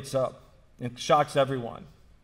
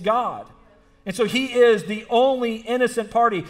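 raised, emphatic sermon voice; higher-pitched, insistent pulpit delivery; impassioned preaching; 7 semitones higher, 6 dB louder than usual. Real, not acted. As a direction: preach it with force, driving each phrase home. Preacher builds from story into sermon.

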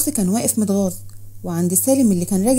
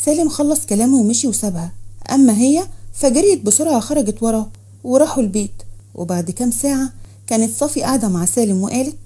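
ordinary delivery

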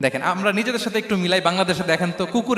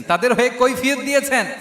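high, raised preaching voice; emphatic proclamation; 6 semitones higher, 3 dB louder than usual. Real, not acted. Preacher telling how he pleaded for his people, emphatic. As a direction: hammer the point home at raised pitch.

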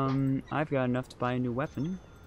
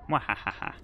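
evil villain cackle, mwahahaha; mad scientist laugh. Pretend. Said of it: "I didn't say mwahahaha".

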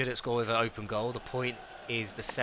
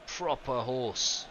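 horse impression; much slower than usual. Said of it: "That was my horse impression".

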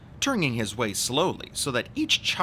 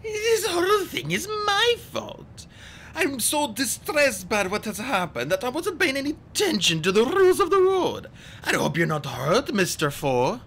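high simpering fake distress; put-on distressed flutter; high fluttery voice; 4 dB louder than usual. A narrator's reading. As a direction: gush apologies in a fluttery high voice. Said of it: "in a high, fluttery voice".

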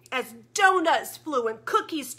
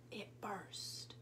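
unvoiced hushed whisper; 6 semitones lower, 21 dB quieter than usual. Real, not acted. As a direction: breathe it out in awe. Hushed aside after explosion.

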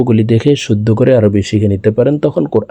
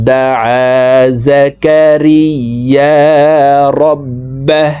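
slow, drawn-out chanted recitation; Quran recitation; 3 semitones higher, much slower than usual. Shown as a demonstration. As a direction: intone it slowly like tilawat. Teacher recites the verse. (ধীর সুরেলা তিলাওয়াত)

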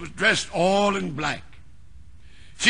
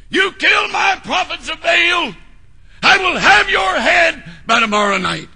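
higher and louder, a menacing threat; threatening queen; 8 semitones higher, 6 dB louder than usual. Retold or imitated. The woman threatening Elijah by message, angry. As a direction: snarl the threat at the prophet.

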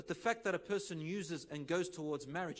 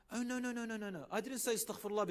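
eager denial; 6 semitones higher, faster than usual. Retold or imitated. Friend protests in story.

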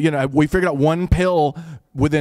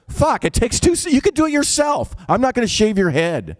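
high-pitched, exasperated protest; acted-out indignant refusal, pitch jumping up; 9 semitones higher than usual. Comic refusing to shave brother's head, exasperated and high.

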